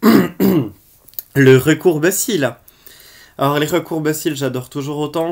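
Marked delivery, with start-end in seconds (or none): 0.0-2.5 loud, very slow announcement of the product name; product title announcement. Acted. Vlogger introducing the next product to viewers, loud and drawn out. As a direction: boom out "Le recourbe-cils." like a show title.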